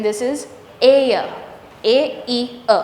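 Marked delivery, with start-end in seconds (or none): 1.8-2.8 "A, E, A" as three separate vowels; separated vowel sounds. Shown as a demonstration. Teacher breaking down a triphthong, separated vowel sounds. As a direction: sound out "A, E, A" piece by piece. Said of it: "three together".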